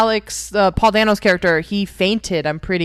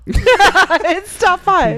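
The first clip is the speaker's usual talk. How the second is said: slow, laughing, high playful teasing; 10 semitones higher, 6 dB louder, much slower than usual. Real, not acted. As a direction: tease him while laughing.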